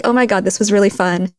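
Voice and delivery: normal talking voice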